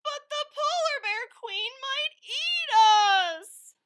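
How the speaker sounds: very high, slow, loud tearful wail; cartoon sobbing loon; crying wail. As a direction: wail in fear of being eaten. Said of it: "wailed Loony Little".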